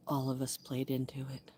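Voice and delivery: hushed whispered confession; whispered admission. Real, not acted. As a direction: breathe it out in a whisper. Whispered aside.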